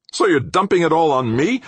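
loud, high indignant snap; snapping. A narrator's reading. Blamed engineer turning on his colleague, snapping. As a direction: snap back at the accuser. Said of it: "Obermann snapped".

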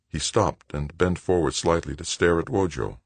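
usual speaking voice

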